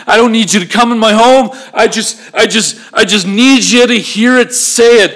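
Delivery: high, insistent declaration of faith; fervent confidence. Acted. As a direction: insist with rising fervour, piling up the lines. Voicing the centurion's confident faith.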